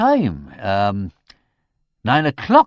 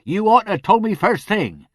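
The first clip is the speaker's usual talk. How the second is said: louder, higher exasperated rebuke; 12 semitones higher, 8 dB louder than usual. A narrator's reading. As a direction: scold him with mounting exasperation. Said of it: "with rising exasperation".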